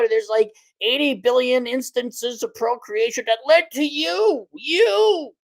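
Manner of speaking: hammed-up lecture on ancestry; mock lineage preacher. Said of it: "when you say".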